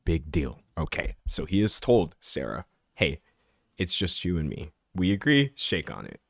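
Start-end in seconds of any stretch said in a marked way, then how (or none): 2.9-6.2 quiet, low imagined agreement; low-key confiding. Retold or imitated. Man tells Sarah it's just them, low and hushed. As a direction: voice a hushed, low little deal between partners.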